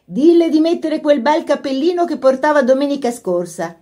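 high, lilting motherly call; Mrs Sheridan. A narrator's reading. Mother calling a message down the stairs, high and lilting. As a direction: float the words down from upstairs. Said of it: "La voce della signora Sheridan fluttuò per le scale".

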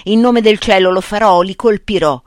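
loud, high oath hurled at the crowd; shouting loudly. A narrator's reading. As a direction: bellow the threat with full force. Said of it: "gridò a gran voce". (giuramento gridato a gran voce)

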